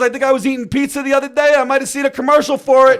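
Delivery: loud, high imitation of a dad; a father hollering unhelpful reports at his son; dad's nagging. Dad tells son about the TV show, loud nagging. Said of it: "my dad would be like".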